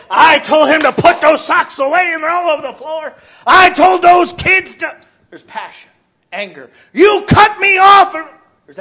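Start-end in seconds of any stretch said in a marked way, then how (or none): 0.1-5.0 loud, high, slowing exasperated rant; angry parent. Acted. Parent ranting about socks on the floor, loud and high. 6.9-8.2 loud, high, slow snap; angry driver. Acted. Driver cut off in traffic, loud angry shout.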